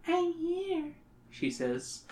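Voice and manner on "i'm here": put-on high, soft eerie little girl; a ghostly child close by in the dark; creepy child voice. A narrator's reading. Ghost child Katie speaks.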